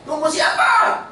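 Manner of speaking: high, slow shout across the room; shouted question. Retold or imitated. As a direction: classmate shouting who they wait for.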